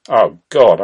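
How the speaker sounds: loud exasperated outburst. Acted. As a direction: burst out in exasperation. Kirk reacts to phone menu.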